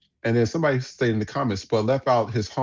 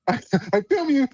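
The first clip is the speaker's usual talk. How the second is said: mock imitation; 13 semitones higher, much slower than usual. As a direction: parody him, pitched way up. Mimicking the Filipino man filming.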